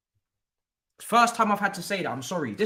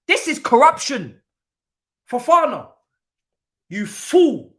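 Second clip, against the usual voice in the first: angry outburst; 7 semitones higher, 9 dB louder than usual. Real, not acted. Fan rants at his team.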